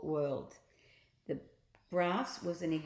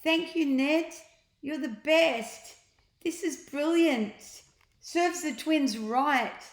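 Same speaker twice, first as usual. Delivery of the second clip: put-on higher, louder, slow gleeful kids' voices; delighted children; 8 semitones higher, 6 dB louder, slower than usual. Children thanking Ned for their toys, high, loud and gleeful. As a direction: gush like thrilled children getting toys.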